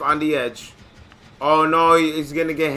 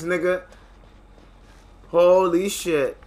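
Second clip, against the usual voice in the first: ordinary delivery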